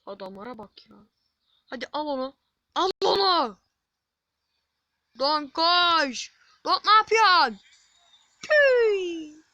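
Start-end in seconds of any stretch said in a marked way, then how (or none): 2.8-3.6 loud, high yell at the players; urgent shout. Real, not acted. Gamer yelling at his players to take the ball, urgent shout. 5.2-9.4 real full-volume, high-pitched yell; frantic shout. Real, not acted. Gamer yelling at Donk to run, frantic shout. Panicked yelling at his player.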